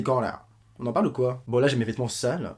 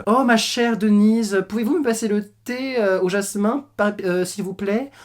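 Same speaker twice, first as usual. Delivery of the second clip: high, slow, hammed-up genteel lady; posh mock speech; 6 semitones higher, slower than usual. Mocking Roman with an overly refined tea-party voice. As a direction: mince each word like a snob at tea. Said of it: "tu veux qu'on parle comme ça".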